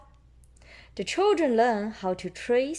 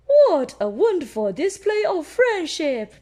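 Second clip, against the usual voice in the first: high, louder admiring teacher; 8 semitones higher, 7 dB louder than usual. Acted. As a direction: praise the class warmly. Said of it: "Madame Gazelle says".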